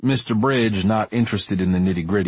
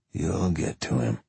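cold flat statement; 2 semitones lower, 6 dB quieter than usual. Acted. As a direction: say it like iron, no emotion. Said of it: "cold and unbending like iron".

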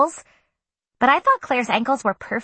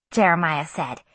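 ordinary delivery

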